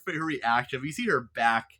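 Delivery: ordinary talk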